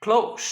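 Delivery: ক্লোজ ending in a voiceless sh-like s; ক্লোজ with a hissed final sound; adjective pronunciation. Shown as a demonstration. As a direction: say ক্লোজ as klohsh. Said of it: "উচ্চারণ হবে".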